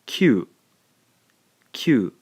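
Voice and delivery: "Q" said as kyu, one syllable, loud and clear; one clean beat for "Q" as kyu; blended kyu. Shown as a demonstration. Teacher calling out the blended sound, loud and clear. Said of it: "we end up with".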